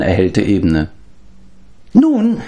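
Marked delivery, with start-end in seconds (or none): 1.9-2.4 high, whimpering creature voice, slow on nein; a pitiful little creature complaining; whining beast-man. Acted. Gurgi complains he gets no thanks, high whining creature voice.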